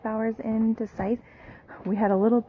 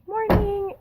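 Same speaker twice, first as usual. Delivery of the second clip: sing-song greeting; 11 semitones higher than usual. Real, not acted. Greeting camera in morning.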